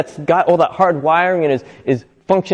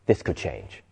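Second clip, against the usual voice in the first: much softer and lower inner murmur; quiet inner voice; 10 semitones lower, 11 dB quieter, slower than usual. Retold or imitated. The hopeful part inside despair, speaking soft and low. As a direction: say it low, as a hidden hope. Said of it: "still going like".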